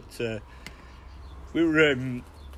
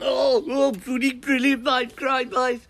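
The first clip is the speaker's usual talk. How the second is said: much higher, louder, put-on voice; excited Brummie mate; 11 semitones higher, 5 dB louder than usual. Mate cheering before mooning the van, high excited voice. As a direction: crow it like a gleeful mate. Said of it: "And he's gone".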